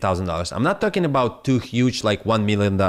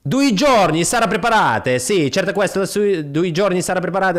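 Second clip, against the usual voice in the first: hammed-up Italian mechanic voice, pitched up; mock Italian mechanic, higher; 6 semitones higher than usual. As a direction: play the stage Italian mechanic reassuring a customer. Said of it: "I was playing the mechanic".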